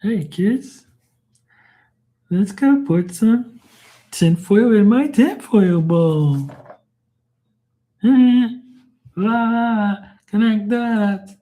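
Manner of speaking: very high, loud, drawn-out cartoon man-child; Pee-wee Herman impression. Mocking a kids' show host for laughs, squeaky impression. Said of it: "I used to do Pee Wee's voice all the time".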